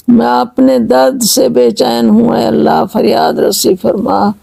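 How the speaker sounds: usual speaking voice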